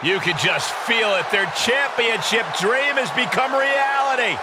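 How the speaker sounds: rising, loud celebratory call; excited commentary. Acted. Commentator celebrating a championship dream, excited. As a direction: build to a big shout on the dream.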